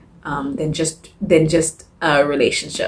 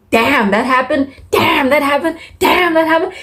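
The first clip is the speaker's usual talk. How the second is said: mock-stunned, each repeat climbing higher; stunned reader; 9 semitones higher than usual. Reader reacting to plot twists.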